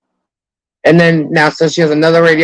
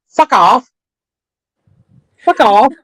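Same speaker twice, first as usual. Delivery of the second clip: much higher, imitating Els's accent; playful impression of Els's accent; 8 semitones higher, slower than usual. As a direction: say it the way Els says it. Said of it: "talk in Elle's tone".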